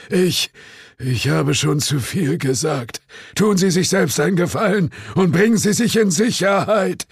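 high, urgent warning; anxious warning. A narrator's reading. Innkeeper urging guests to get to safety, high and urgent. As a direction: warn them off, voice pitched right up.

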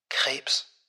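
hushed, barely voiced single word; whisper. A narrator's reading. Secretary revealing her boss's illness, whispering. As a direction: drop to a grave whisper. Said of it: "Sie senkte die Stimme zu einem Flüstern".

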